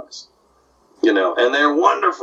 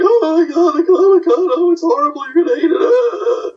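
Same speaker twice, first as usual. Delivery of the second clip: very high, hammed-up crying; mock wailing; 14 semitones higher, 3 dB louder than usual. Fans lamenting the changed Comic-Con, mock crying and wailing. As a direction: wail like a crowd in exaggerated despair. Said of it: "everybody was crying and wailing".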